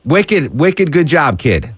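lightly put-on Boston accent praising the guest; mock Boston accent. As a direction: tease her with a light Boston twang. Host teases her about Boston accent.

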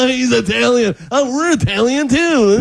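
high-pitched mock gushing; starstruck fan. Imitating fans thrilled by an Italian celebrity, high mock excitement. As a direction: gush like an easily impressed fan, voice way up.

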